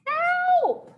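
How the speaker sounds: high-pitched drawn-out call for rescue; screaming for help. Retold or imitated. Child stuck in a tree calls mom, mock screaming. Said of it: "I started screaming for someone".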